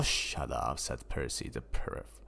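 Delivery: ordinary delivery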